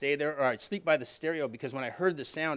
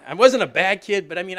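higher and louder, laughing through it; 5 semitones higher, 9 dB louder than usual. Real, not acted. Confessing kid mischief to the audience, laughing. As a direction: chuckle while owning up. Laughing at his own past.

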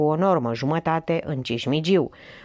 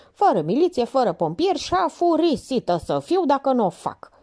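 a fed-up chief laying down the law; Minerva, fed up; 8 semitones higher than usual. Acted. Minerva's outburst to subordinates.

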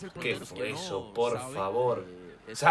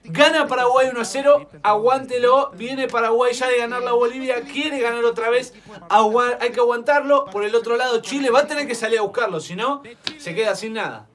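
half-time announcer; 6 semitones higher, 5 dB louder than usual. Real, not acted.

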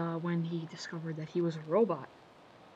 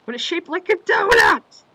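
much higher, much louder indignant shout; dim henchman shout; 12 semitones higher, 17 dB louder than usual. Retold or imitated. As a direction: yell it like a dope defending his donut. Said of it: "Knuckle from the first episode".

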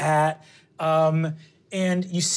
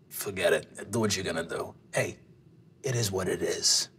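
deep, quiet dismissive grumble; low resigned brush-off; low gruff old man; 11 semitones lower, 7 dB quieter than usual. Acted. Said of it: "he looks and goes".

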